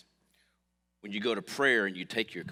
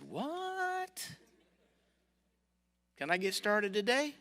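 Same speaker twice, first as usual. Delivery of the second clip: much higher, shocked squeak then eager; mock astonished yelp; 12 semitones higher, 4 dB quieter, slower than usual. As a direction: pop up in disbelief. Retold surprise at low cost.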